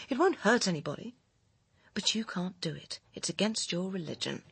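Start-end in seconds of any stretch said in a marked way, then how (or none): 1.9-3.0 sneering whisper; scornful whisper. Acted. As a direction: breathe the taunt out bitterly. Scornful jab at lover.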